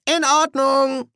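very high, slow, loud squeak; nervous compliance. Acted. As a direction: agree timidly in a strained high voice. Frightened Nobby obeys guard.

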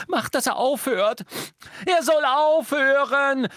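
put-on panicked shrieking girl; screaming girl. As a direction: scream at them to make it stop. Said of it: "schrie Hermine".

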